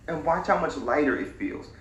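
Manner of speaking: ordinary talk, nothing marked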